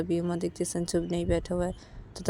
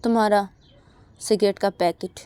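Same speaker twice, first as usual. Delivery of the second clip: louder, scornful taunt offering the cigarettes; angry taunt; 3 semitones higher, 8 dB louder than usual. Acted. Uma taunts Sanju, handing back his cigarettes, scornful and loud. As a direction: shove the packet at him with contempt. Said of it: "Sanju को बोलती है कि".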